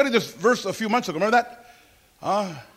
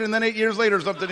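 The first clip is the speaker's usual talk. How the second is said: usual speaking voice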